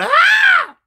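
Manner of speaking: long, very high mock scream; loud, piercing imitation shriek; hollered scream. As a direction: imitate her hollering at full pitch. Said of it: "She hollered".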